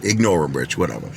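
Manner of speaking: low, soft acted reassurance; calming down. Joey calming Rich after shots, acted low and soothing. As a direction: talk a friend down quietly, voice dropped. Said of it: "Joey calmed me down".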